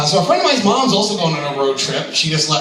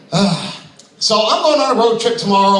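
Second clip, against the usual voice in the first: usual speaking voice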